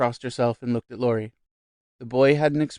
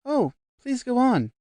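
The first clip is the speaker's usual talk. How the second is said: high, slow amiable urging; a touch of genial encouragement; 12 semitones higher, 3 dB louder than usual. A narrator's reading. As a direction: coax her on kindly. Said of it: "said Maggie genially".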